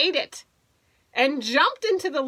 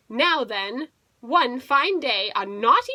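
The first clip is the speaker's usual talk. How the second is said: ordinary delivery, nothing marked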